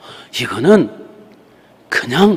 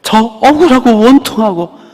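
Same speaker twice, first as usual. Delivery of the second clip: high, loud pleading wail; anguished cry to the Lord; 7 semitones higher, 3 dB louder than usual. Retold or imitated. Preacher acting out her anguished prayer to the Lord, high wailing plea. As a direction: cry out in anguish before God.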